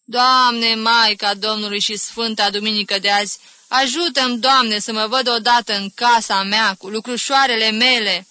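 loud, high, plaintive plea; pleading prayer. Acted. Aunt crossing herself, longing for her own house, pleading prayer. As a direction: implore heaven with a lamenting voice. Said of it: "Închinându-se".

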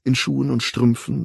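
ordinary delivery, nothing marked

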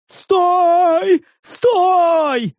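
high, drawn-out yell to stop; panicked cry. A narrator's reading. Stranger begs the orc to stop, panicked yell.

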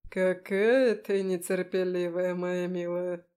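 indulge her like a smug demon; amused demon. A narrator's reading. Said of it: "Демон рассмеялся". (насмешливый голос демона)

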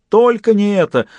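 high, melodramatic protest; theatrical cry. A narrator's reading. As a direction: plead in hammed-up horror. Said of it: "театрально вскричал Фист".

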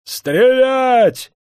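high, loud, sustained scream; frantic high-pitched command; hysterical shout. A narrator's reading. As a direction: scream the order in panic. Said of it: "истерически закричал он".

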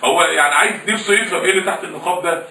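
ordinary talk, nothing marked

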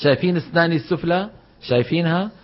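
ordinary talk, nothing marked

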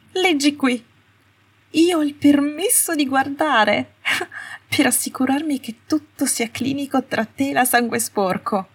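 shrill high hysteria with a broken laugh; hysterical. Acted. Astoria reading the note to Malfoy, hysterical and shrill. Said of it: "Astoria sembrava così isterica".

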